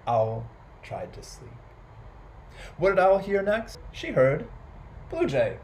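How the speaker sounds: usual speaking voice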